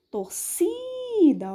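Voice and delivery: "Torcida" with a high, long CI; stressed middle syllable. Shown as a demonstration. Teacher gives a paroxytone example, long high CI in "Torcida". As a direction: rise onto CI in "Torcida" and hold it. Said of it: "ele é a mais forte".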